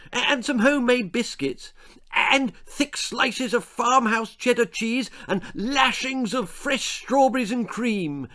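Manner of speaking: high eager schoolboy listing treats. Acted. Algy adds treats to his picnic wishes, eager high boy voice. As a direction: pile on the treats, boyishly greedy.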